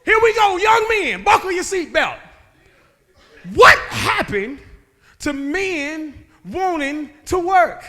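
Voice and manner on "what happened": ordinary talk, nothing marked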